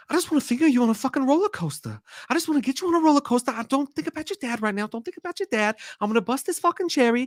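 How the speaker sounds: high, brash Boston tough-guy rant; lightly voiced Wahlberg from Fear; put-on Mark Wahlberg voice. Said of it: "I just wanna punch you in your fucking teeth when you do that".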